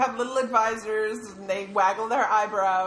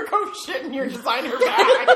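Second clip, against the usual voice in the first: laughing; 9 semitones higher, 6 dB louder than usual. Real, not acted. Joking, bursts into laughter.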